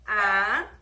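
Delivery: "A" said alone, loud and held; first syllable isolated. Shown as a demonstration. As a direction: sound out "A" as its own syllable. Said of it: "Dois pedacinhos". ("A" sozinho, bem alto)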